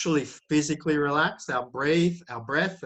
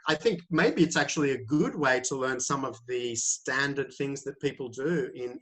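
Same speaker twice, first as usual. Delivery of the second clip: ordinary talk, nothing marked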